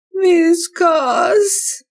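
high, loud sobbing cry of the name; crying wail. A narrator's reading. Girl crying out for her cat, wailing. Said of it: "jammert Flo".

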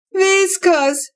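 high, loud lament of the name; wailing. A narrator's reading. Girl mourning her cat, wailing her name. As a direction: wail the cat's name in grief. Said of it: "wehklagt Flo".

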